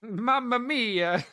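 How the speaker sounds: high, slow, hammed-up Italian cry of "Mamma mia"; a cartoon Italian gasping in horror, stretched out; Italian accent. Mocking the Lamborghini board's horror in a hammed-up Italian accent. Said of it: "the Italians' look of horror".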